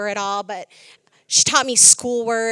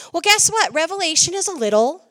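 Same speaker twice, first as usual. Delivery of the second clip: usual speaking voice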